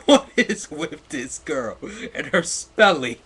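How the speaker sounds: cracking up at the spelling; laughing disbelief. Real, not acted. Reader mocks the author's terrible spelling, laughing.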